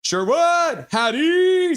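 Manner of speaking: high, loud stretched cheer; elated shouted chat message; celebratory yell. Acted. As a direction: belt out each word like a goal call. Reads chat's cheers aloud, celebrating.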